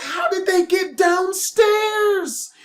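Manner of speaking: high, slow, drawn-out incredulous cry; astonished disbelief. Retold or imitated. Storyteller seeing the chicks got outside, stunned and incredulous. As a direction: stretch each phrase in amazed disbelief.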